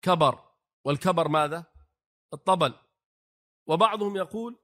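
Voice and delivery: ordinary delivery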